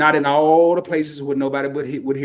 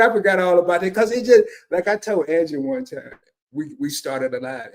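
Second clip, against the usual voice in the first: ordinary delivery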